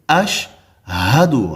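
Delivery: اشہدو very slow with a clear h after sh; correct ash-hadu. Shown as a demonstration. Teacher models the right word, very slow. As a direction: stretch اشہدو to let the h be heard. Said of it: "ہا بھی ہے اس میں".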